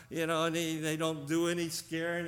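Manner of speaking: ordinary talk, nothing marked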